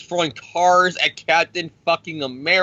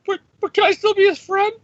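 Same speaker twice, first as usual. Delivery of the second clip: very high, squeaky, pleading voice; Tony begging Dick Cheney on a call, squeaky and high; whiny Tony Stark; 3 dB louder than usual. Retold or imitated. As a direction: whimper it like a nervous kid. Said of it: "he's on a call with Dick Cheney".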